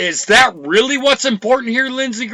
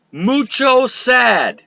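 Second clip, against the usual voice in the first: mock quote; 4 semitones higher, much slower than usual. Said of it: "I wanna quote him on this".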